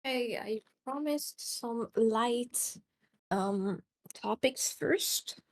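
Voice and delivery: slow drawl. Real, not acted. Slow drawn-out reminder.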